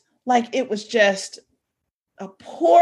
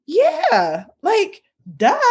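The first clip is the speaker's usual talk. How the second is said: put-on sassy teen; 8 semitones higher, much slower than usual. Daughter's sassy reply voiced.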